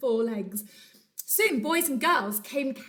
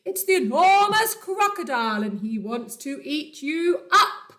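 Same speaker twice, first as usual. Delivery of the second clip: louder, higher elephant warning; bellowing warning; 5 semitones higher, 8 dB louder than usual. Acted. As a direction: boom the danger out. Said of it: "he bellowed".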